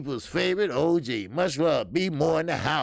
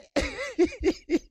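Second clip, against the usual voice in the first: sudden high-pitched burst of laughter. Real, not acted. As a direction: let a high laugh break out. Laughing at own joke.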